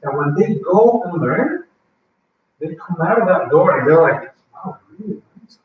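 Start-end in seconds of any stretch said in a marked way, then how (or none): 4.5-5.6 awed whisper. Retold or imitated. Student leaving class, amazed.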